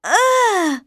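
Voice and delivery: high grimacing groan of disgust; high-pitched yuck groan; disgusted ugh. Acted. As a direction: recoil from the taste. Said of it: "she said".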